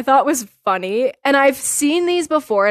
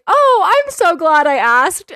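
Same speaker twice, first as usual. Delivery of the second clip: Elmo's reply; 7 semitones higher, 5 dB louder, slower than usual. Retold or imitated. Elmo's ironic reply quoted.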